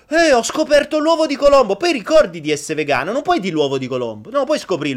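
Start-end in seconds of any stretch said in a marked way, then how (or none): 0.1-1.8 excited. Pretend. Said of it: "metti che scopri una cosa nuova e dici".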